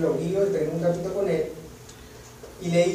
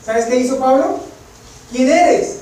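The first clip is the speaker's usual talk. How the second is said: louder, higher, ringing voice; preaching at full force; 6 semitones higher, 8 dB louder, slower than usual. Acted. Preacher crying out Paul's question, loud and high. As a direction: proclaim it to the whole hall.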